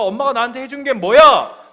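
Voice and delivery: put-on loud, accusing teenage outburst; child loudly accusing her mother; resentful child. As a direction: throw it at mom in anger. Child voiced blaming mother.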